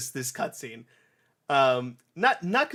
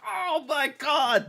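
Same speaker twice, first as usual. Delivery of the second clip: much higher, slow amazed gasp; much higher, slow awestruck delight; childhood awe; 13 semitones higher, 3 dB louder, much slower than usual. Retold or imitated. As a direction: stretch it out in wonder. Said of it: "I was like".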